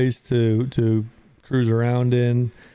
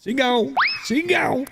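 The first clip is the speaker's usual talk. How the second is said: high mock voice; 11 semitones higher than usual. Echoing chat in silly voice.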